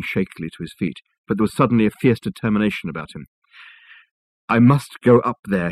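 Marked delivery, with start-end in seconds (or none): none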